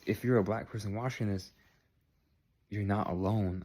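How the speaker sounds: usual speaking voice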